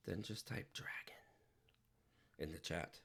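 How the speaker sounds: "then just type dragon" in a hushed whisper; whispered instruction. Acted. Streamer whispers the giveaway entry instruction to chat.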